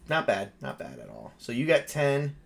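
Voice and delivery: ordinary talk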